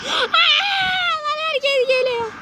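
slow, high, crying panic; panicked cry. Real, not acted. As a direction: wail it out in terror. Horror game chase, panic.

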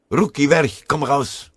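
loud, high barked command; bellowing. A narrator's reading. Hähnel yelling at the Russian sniper to come out, loud bellow. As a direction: roar it at the hidden enemy. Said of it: "Eine ihm bekannte Stimme brüllte".

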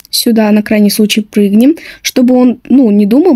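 ordinary talk, nothing marked

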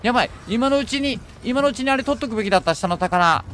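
real fright at the monster; startled panic. Real, not acted. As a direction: blurt it out as the monster appears. Sees a huge monster appear.

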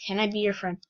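ordinary talk

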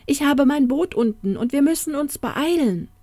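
high-pitched, pressing entreaty; anxious, pleading woman's voice; urgent pleading. Acted. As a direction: urge him to hurry. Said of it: "bat sie".

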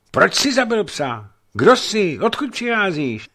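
high, loud furious questions; a youth angrily confronting a stranger; angry shout. Acted. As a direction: demand answers, outraged. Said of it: "zvolal hněnivě".

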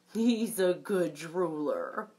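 low, slow and fond; a deep easy dad voice, pleased; lightly voiced proud papa. Papa bunny reassuring Dot about the baby, in a low slow voice.

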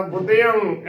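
ਬੁੱਧਿਅੰ said bud-dhe-ang, nasal ending; correct pronunciation. Shown as a demonstration. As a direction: stretch ਬੁੱਧਿਅੰ into "buddhe-ang". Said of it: "ਸਿਹਾਰੀ ਦੇ ਨਾਲ ਕਰਨਾ".

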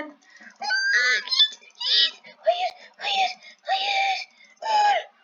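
very high, frantic shrieks of no and go; panicked screaming. Real, not acted. Gamer panicking at an enemy player, shrieking very high.

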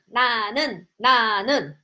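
ordinary talk

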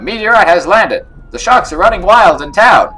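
game character announcing alarm. Acted. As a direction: sound the alarm loudly.

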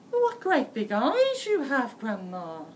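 put-on a small girl wondering aloud; little girl voice. A narrator's reading.

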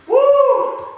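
high, loud celebratory whoop; excited whoop. Real, not acted. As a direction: whoop to pump up the class. Coach whoops during inchworms.

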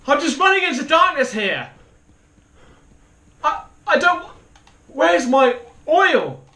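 very high, loud distress; real high-pitched panic; panicked yelling. Real, not acted. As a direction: cry out frantically for what is missing. Lost in the dark, panicking.

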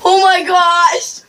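high, slow shocked cry. Real, not acted. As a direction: gasp it out in disbelief. Sees her finished makeup.

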